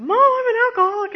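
high, loud, fast blurted confession; fast panicked blurt to mom. Retold or imitated. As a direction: spill it out to mom all at once.

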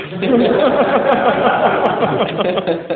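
high, loud burst of laughter. Real, not acted. Storyteller cracking up at his own line, loud laughter.

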